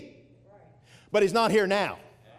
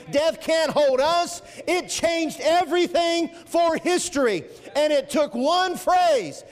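rising preaching fervour; 6 semitones higher than usual. Acted. Preacher telling the church death can't hold us, building to a shout. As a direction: lift the congregation, climbing in force.

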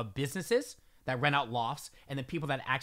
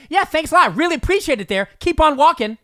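loud, higher, fast mock-grateful; loud sarcastic thanks; 10 semitones higher, 12 dB louder than usual. Calling up to the woman on the stairs, loud and sarcastic. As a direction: shout fake gratitude at her back. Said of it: "I said it again".

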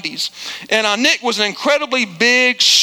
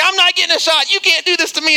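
high-pitched mock tantrum; acting crazy; 6 semitones higher than usual. Son on the table refusing the nurse's shot, frantic. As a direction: imitate a kid frantically refusing. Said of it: "He acts crazy".

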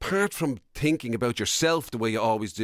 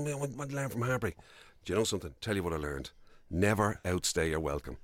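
softer, lowered confiding advice; mock wise hush; 6 semitones lower, 7 dB quieter than usual. Answering the heartbreak question, mock sage hush. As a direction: deliver it like hard-won wisdom, hushed.

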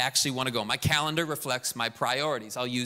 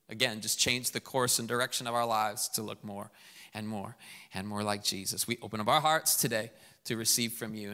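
prayer; 4 semitones lower, 3 dB quieter than usual. Acted. Pastor prays over the church.

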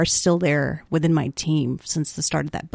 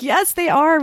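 much higher, eager yes; high emphatic agreement; 9 semitones higher than usual. Retold or imitated. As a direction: agree a bit too eagerly. Her reply.

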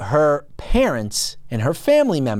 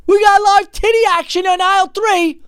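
mock outraged shout; 6 dB louder than usual. Host hollering about live action on aisle three, mock outraged shout. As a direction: holler it like an alarm down the store aisles. Mock outburst at a shocking scene.

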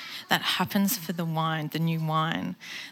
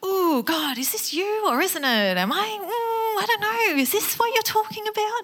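much higher, half-laughing, half-crying questions; giddy nervous prayer; 12 semitones higher than usual. Retold or imitated. Speaker asking God if this is his sign, high, laughing and teary. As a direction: gush at God in giddy disbelief.

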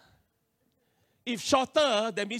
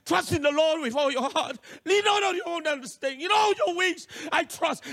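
higher, impassioned scripture declaration; fervent recitation; 6 semitones higher than usual. Retold or imitated. Nervous learner preaching scripture to himself, fervent. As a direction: preach it to yourself with rising urgency.

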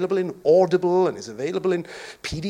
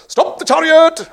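loud high command to halt the chariot; commanding shout; 10 semitones higher, 11 dB louder than usual. Acted. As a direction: bark the order like a ruler. Eunuch commanding the chariot stop.

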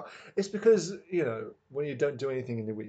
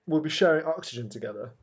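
ordinary talk

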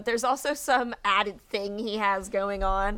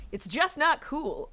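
higher, slow phrase leaning hard on "cool"; stress on "cool"; 6 semitones higher, slower than usual. A narrator's reading. Reading Olaf's snappy catchphrase to a co-host, stress on "cool". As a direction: draw out "cool" slowly, pitched up. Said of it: "to emphasize the word cool".

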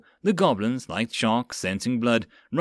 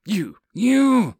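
high, loud, drawn-out second word; splutter, then burst out in outrage; sputtering rage; 9 semitones higher, 6 dB louder than usual. Acted. Lieutenant near heart attack with fury, sputtering.

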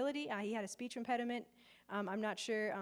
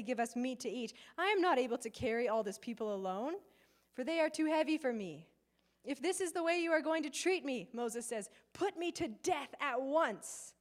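louder, emphatic reading of Moses' complaint; dramatic scripture reading; 3 semitones higher, 4 dB louder than usual. Acted. Preacher reading Moses' protest to God aloud, dramatic and emphatic. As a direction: read it out with mounting, pleading indignation.

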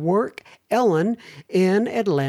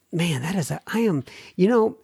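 ordinary talk

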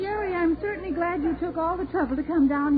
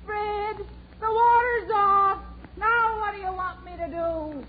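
high, drawn-out call; 6 semitones higher than usual. Acted. Wife relaying news to husband, calling out. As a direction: send it high and slow to someone out of sight.

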